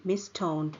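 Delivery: usual speaking voice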